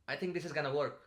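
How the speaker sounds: high and loud, voice pushed up; high pitch. Shown as a demonstration. Teacher showing the wrong way, high and loud. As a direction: say it up high like an unsure guess. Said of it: "talking with a very high pitch".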